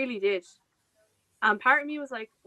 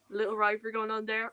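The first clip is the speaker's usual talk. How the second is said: normal talking voice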